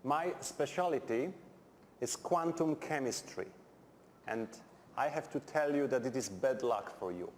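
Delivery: usual speaking voice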